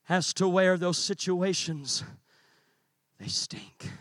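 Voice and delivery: very soft and low, intimate; dropping to a near hush. Real, not acted. Preacher quietly turning to the young people, hushed. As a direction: pull right back and lean in close.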